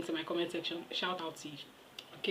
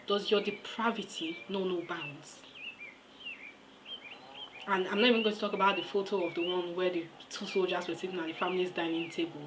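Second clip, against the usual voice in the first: normal talking voice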